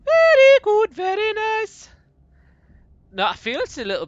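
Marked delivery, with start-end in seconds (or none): 0.0-1.9 very high falsetto, stretched out on nice; squeaky catchphrase voice. Pretend. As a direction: squeal it like a silly cartoon voice. Repeated catchphrase in put-on voice.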